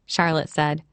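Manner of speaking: plain reading voice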